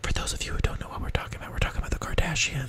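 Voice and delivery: hushed whispered aside; stage whisper. Pretend. Host explaining to uninformed listeners, whispering.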